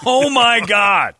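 high, loud, stretched-out exclamation; hammed-up scandalised outburst; mock shock. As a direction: cry out in drawn-out disbelief. Mock-shocked reaction to the slur.

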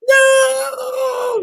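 high screaming "No!"; grown-up Sarah shrieking at the magician. Pretend.

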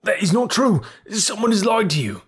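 high, strained protest; frantic denial. A narrator's reading. Cornered thief denying the charge, high and frantic. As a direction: insist desperately he is innocent.

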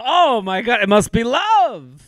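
hammed-up, high-pitched amazement; mock gushing. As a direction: gush as if a humble gift proves true love. Mocks the gift list as love.

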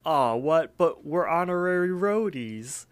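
high, drawn-out whiny objection; groaning protest. Retold or imitated. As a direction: imitate two let-down slackers complaining. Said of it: "Mordecai and Rigby kinda groan".

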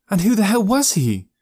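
high-pitched, angry questioning; angry demand. A narrator's reading. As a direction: demand an answer, pitch shooting up. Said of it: "hearing the anger in her voice".

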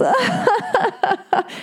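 very high, exaggerated imitation laugh; hammed-up mocking titter, held long; mock derisive laugh. Musician laughing at her about turtles, mock derisive. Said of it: "he, like, laughed with her".

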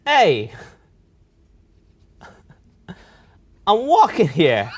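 loud, slow New York accent; drawn-out, hammed-up New Yorker protest. Shown as a demonstration. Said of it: "just give us a good, "I'm walking here".

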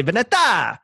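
"Veneta" with a flourished last syllable; party-like pronunciation. Shown as a demonstration. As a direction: make "Veneta" a little party cheer, ending on "-ta". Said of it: "Sounds like a nice, like, little party thing".

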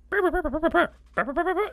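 high chirping burr burr; creature noise. Retold or imitated. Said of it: "she was just like".

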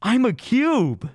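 awestruck tripping friend. Retold or imitated. Tripping friend repeating his revelation, awestruck. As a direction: insist on it in high-pitched, stoned amazement.

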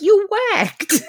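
high-pitched hammed-up gangster scold; high mock Vincenzo. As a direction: scold her like a movie mobster. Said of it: "Vincenzo's like".

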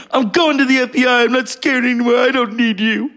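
high-pitched acted outburst; crazed defiance. Casino character telling off Joe Pesci, crazed and defiant. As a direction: imitate a movie character's wild defiance. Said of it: "She played crazy really well".

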